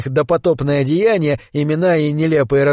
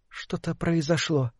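said softly, barely above a murmur; quiet; 2 semitones higher, 10 dB quieter than usual. A narrator's reading. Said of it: "сказала Лилия негромко".

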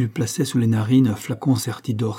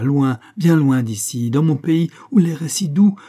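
loud, raised, ardent pleading; impassioned promise; 5 semitones higher, 5 dB louder than usual. A narrator's reading. Young man promising Sanda escape to his country: impassioned. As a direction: carry them away with a fervent, lifted voice. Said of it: "rugissait le jeune homme".

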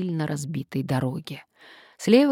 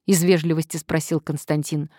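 ordinary delivery, nothing marked

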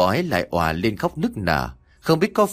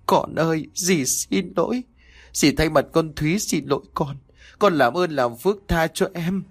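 higher, pleading through tears; weeping bitterly; 6 semitones higher than usual. A narrator's reading. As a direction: beg forgiveness while sobbing. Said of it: "khóc lóc thảm thiết". (khóc lóc thảm thiết, giọng cao van xin)

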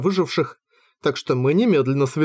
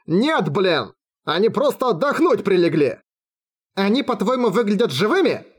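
sarcastic bark, pitched up; higher, scornful retort; 6 semitones higher than usual. A narrator's reading. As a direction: snap back in exasperation. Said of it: "рявкнула я".

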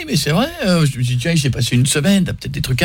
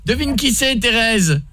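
prank caller in a put-on Midi accent, pitched up; southern French accent; 5 semitones higher, slower than usual. Prank caller to Thérèse, fake Midi accent. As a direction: sing the Midi twang while asking her to guess. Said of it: "qui a un accent".

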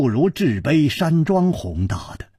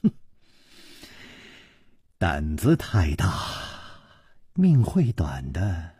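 cold sneer; 9 semitones lower, 5 dB quieter than usual. A narrator's reading. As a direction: sneer a quiet warning. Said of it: "冷笑着说". (冷笑着低声威胁)

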